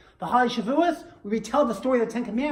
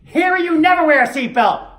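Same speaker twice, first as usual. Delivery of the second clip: high, loud mock scolding; nagging wife; 9 semitones higher, 11 dB louder than usual. Wife contradicts her husband in front of the officer, loud nagging. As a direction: blurt it out as the exasperated wife, pitched way up.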